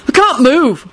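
high, indignant protest; heated complaint. Acted. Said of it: "Neville replied heatedly".

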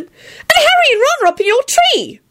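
very high-pitched report; yell. A narrator's reading. Ginny tells Lupin about the boys, yelling. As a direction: call it out high and urgent. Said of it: "Ginny yelled at Lupin".

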